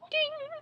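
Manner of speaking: high playful chime sound; vocal ding. Pretend. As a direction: sing a little bell ding. Imitating on-screen number chime.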